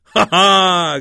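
loud mock-frightened exclamation; put-on fright; pretend-scared cry. A narrator's reading. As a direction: cry out in feigned fear, high and loud. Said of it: "krzyknął mały Klaus, udając, że się boi".

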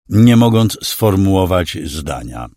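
usual speaking voice

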